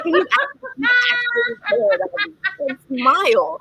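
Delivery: high, loud mock-indignant whine; exasperated pleading. Fighter nagging her coach to smile for pictures, exasperated. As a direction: beg with hammed-up exasperation, stretching the last word. Retold plea for a photo smile.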